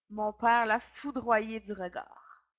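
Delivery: ordinary delivery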